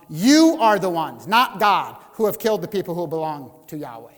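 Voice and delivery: pointed blame, stress on you; accusing people. Retold or imitated. Grumbling crowd blames Moses and Aaron, accusing. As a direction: jab the blame at them. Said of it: "It's like this".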